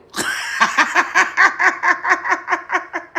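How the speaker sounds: long, high burst of laughter; laughing hard at your own joke; high cackling laugh. Real, not acted.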